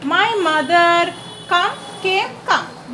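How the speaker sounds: dragged out word by word; slow dictation. Shown as a demonstration. Teacher building the sentence for students, slow and deliberate. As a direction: lay each word down slowly.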